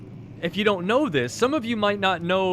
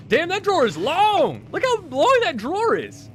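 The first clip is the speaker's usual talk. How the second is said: higher, louder astonished exclaim; amazed; 10 semitones higher, 3 dB louder than usual. Real, not acted. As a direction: marvel out loud at the sight. Amazed at a long drawer.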